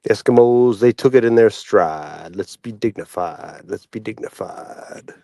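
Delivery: low solemn oratory, fading to a hush; recited famous speech. Acted. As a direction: let the address sink gradually almost to a whisper.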